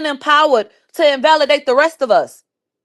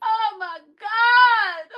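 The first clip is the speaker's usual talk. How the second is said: high, breathy fake sobbing; hammed-up fake sob; mock crying; 7 semitones higher, 4 dB quieter, slower than usual. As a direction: ham up the tears. Fake crying over quote.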